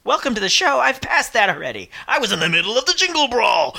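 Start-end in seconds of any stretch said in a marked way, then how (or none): 2.1-3.7 gravelly, rough-edged voice. Real, not acted. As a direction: let the gravelly voice show. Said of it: "Can't you hear my gravelly voice?".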